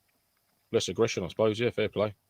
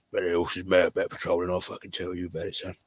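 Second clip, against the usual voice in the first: put-on London accent; 2 semitones lower, 6 dB quieter than usual. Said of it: "They all sound like that".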